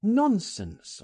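high exclamation of dismissal; crying out. A narrator's reading. Frank dismisses Oscar's fear of the police, crying out. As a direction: brush the fear aside. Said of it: "I cried".

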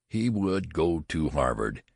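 ordinary delivery

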